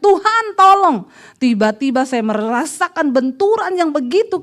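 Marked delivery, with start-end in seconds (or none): 0.0-1.1 higher, louder, slow desperate cry; retold cry for help. Husband crying out to God before the crash, high and loud. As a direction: call out to God in panic. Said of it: "suami saya teriak".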